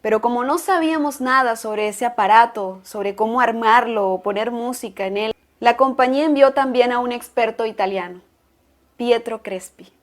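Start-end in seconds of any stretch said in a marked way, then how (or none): none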